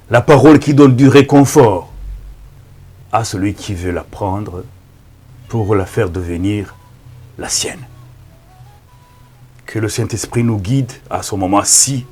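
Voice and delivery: usual speaking voice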